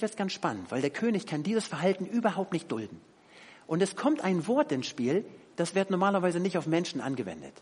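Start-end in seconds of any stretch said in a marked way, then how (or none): none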